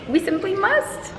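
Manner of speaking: a hint of haughty high voice; mock posh. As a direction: say it like a grand lady. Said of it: "I was like".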